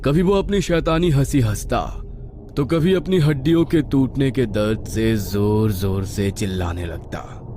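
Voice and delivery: plain reading voice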